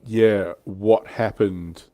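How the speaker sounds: normal talking voice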